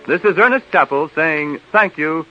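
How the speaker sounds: normal talking voice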